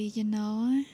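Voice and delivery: ordinary delivery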